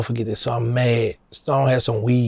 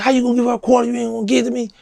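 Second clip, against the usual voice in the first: higher-pitched retold protest at a friend; jealous squabble over a quarter, voice up high; indignant complaint; 11 semitones higher, much faster than usual. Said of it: "we fussing".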